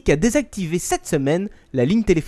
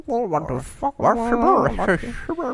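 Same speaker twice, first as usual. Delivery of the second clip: voix d'Anglais ivre, traînée et montante; Anglais éméché; 7 semitones higher, much slower than usual. Retold or imitated. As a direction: imite un Anglais après une pinte. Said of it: "les Anglais, après une bonne pinte". (Anglais éméché, voix traînante et aiguë)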